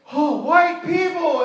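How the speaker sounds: high, slow, loud excited call; excited cry. Retold or imitated. Villagers greeting the visitors, loud and excited. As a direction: shout it out amazed. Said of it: "all of them were like".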